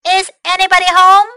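high, loud, quick call. Acted. Snail landed on another planet, calling out. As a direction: call out to whoever might be there. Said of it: "Snail called".

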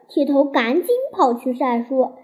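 usual speaking voice

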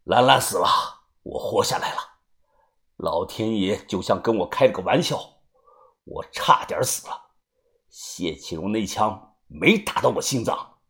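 low, hushed, grim voice; grim returned avenger. Acted. Avenger telling how he survived the shooting, grim and hushed. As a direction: let it out low and soft, with pauses.

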